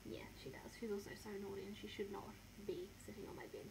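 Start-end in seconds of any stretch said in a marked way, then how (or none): 0.1-0.7 breathy whispered aside. Real, not acted. Owner answering for her dog in a soft whisper.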